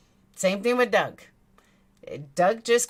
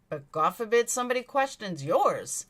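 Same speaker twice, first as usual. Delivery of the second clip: ordinary talk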